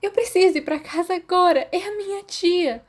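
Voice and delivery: put-on high, tearful plea of a girl; sobbing plea. Girl begging the professor to let her go home, sobbing. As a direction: beg through sobs. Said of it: "Tina soluçou".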